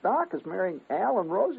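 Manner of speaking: high, amazed question; can't believe Doc is marrying him; disbelieving. Retold or imitated. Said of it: "I said".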